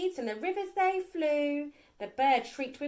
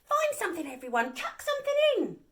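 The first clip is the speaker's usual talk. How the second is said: higher, quick cheerful invitation; cheery call; 8 semitones higher, faster than usual. A narrator's reading. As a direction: invite everyone with a grin. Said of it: "said with a grin".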